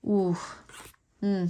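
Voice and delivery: ordinary talk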